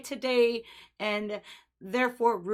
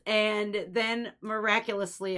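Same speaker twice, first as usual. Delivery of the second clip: ordinary talk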